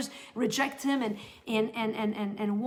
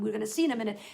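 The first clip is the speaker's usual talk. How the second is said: ordinary talk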